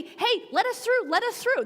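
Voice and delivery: urgent calling out. Retold or imitated. Calling out while running.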